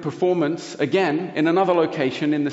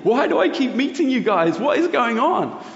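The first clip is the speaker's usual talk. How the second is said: much higher, imitating a baffled man near tears; retelling a stranger's astonished cry of disbelief; bewildered outburst; 10 semitones higher, 4 dB louder than usual. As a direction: voice him overwhelmed and confused, pitched way up. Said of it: "he starts crying again".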